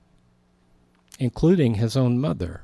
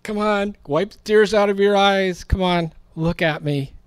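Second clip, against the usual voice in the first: gently coaxing her, pitched much higher; tender coaxing; 9 semitones higher than usual. Retold or imitated. Jesus comforting weeping Mary Magdalene, tender coaxing. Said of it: "Can't you see him going".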